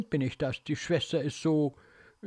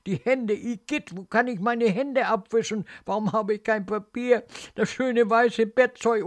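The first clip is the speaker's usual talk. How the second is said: higher, disgusted, desperate rush of distress; child's helpless, revolted plaint; rising panic; 6 semitones higher than usual. Acted. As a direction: panic as the mess spreads everywhere. Soiled child panicking in bed.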